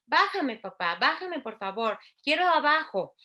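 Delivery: put-on child asking. Shown as a demonstration. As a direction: beg like a toddler to get down. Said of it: "lo pueden complementar con más cosas".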